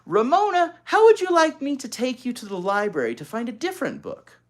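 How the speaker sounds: high, loud inviting offer; coaxing sister. Acted. Older sister tempting little sister to the library. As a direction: coax her sister with a treat. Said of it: "Beezus asked".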